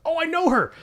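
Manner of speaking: high, loud burst of recognition; acted-out excited squeal of recognition. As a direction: pipe up in delighted surprise. Acts out recognising an actress on screen.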